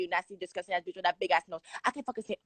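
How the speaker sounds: ordinary talk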